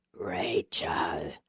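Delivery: low breathy hiss of the name. A narrator's reading. Eric hissing Rachel's name as his body changes. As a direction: hiss it like a beast half-formed.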